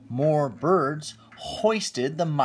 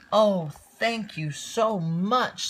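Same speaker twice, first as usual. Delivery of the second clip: high, loud, slow, overjoyed thanks; delighted exclaim; 8 semitones higher, 5 dB louder, slower than usual. Acted. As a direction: gush with grateful joy. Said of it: "Cinderella exclaimed".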